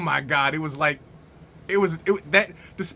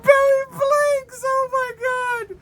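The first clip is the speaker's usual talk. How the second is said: very high, slow laughing squeal; overcome with laughter; slower than usual. Real, not acted. Reviewer marvelling at Billy Blanks, squealing with laughter. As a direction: squeal it out through real laughter. Cracking up over the movie.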